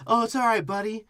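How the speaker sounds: high-pitched coddling voice; mock soothing. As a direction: talk down to a pet in a sweet high voice. Imitates him soothing baby T-Rex.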